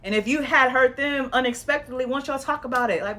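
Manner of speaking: normal talking voice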